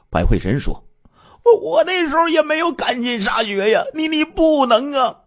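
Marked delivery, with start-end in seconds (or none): 1.4-5.2 high, stammering, frantic protest; desperate pleading. Acted. Doomed man stammering to his killer, high and desperate. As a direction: stutter out a last desperate plea. Victim pleading before being shot.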